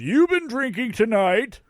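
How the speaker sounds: loud, high-pitched Santa question; merry Santa. A narrator's reading. Santa quizzing a man he's pelting, merry and loud. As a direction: ring it out like a jolly Santa.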